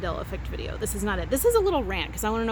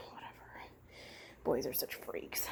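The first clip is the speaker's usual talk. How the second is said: whispered, much softer, lower; whispered aside; 8 semitones lower, 16 dB quieter than usual. Real, not acted. Vlogger muttering a private aside to viewers, whispered.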